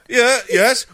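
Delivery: put-on very high, loud unsure kid's voice; a boy squeaking out a yes; hesitant boy. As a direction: son answering the patient's question, high. Boy answers caller.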